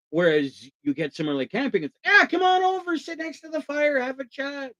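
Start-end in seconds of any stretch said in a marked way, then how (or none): 2.0-4.7 friendly camper. Retold or imitated. Camper welcoming a stranger to the fire, bright high welcome. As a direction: invite them over like an eager camper.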